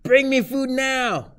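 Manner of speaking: high, loud and slow mock yell at mom; mock scream. Teen demanding dinner from mom, hammed-up high loud yell. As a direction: bellow the order, slow and high. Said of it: "but I scream at her".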